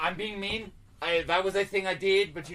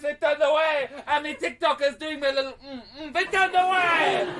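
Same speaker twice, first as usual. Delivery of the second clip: higher, rising theatrical outrage; mounting indignation; 6 semitones higher than usual. Acted. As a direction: build to an aggrieved climax. Indignant escalating list of bystanders.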